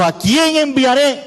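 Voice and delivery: high, drawn-out solemn call; God the Father. Acted. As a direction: call it out as a divine summons. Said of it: "el Padre dijo".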